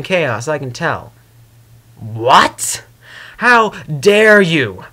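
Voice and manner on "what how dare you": slow, louder indignant "how dare you"; offended outburst. Acted. Discord indignant when Celestia says he cares for Twilight. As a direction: draw it out, stung and indignant.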